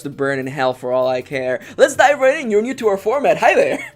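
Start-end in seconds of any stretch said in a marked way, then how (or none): 1.8-3.9 high, loud burst of forced cheer; put-on excitement. Host greeting viewers new to the format, forced high excitement. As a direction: launch in over-eagerly, breaking into a laugh. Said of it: "I have to speak like this to cover up".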